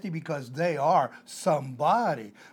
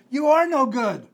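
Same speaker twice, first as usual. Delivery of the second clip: higher, louder, stress on "are"; preacher's blunt verdict; emphatic declaration; 7 semitones higher, 8 dB louder than usual. Real, not acted. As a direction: hit "are" hard and high. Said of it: "my answer is".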